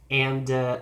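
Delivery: usual speaking voice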